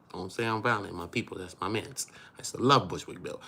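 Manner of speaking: ordinary talk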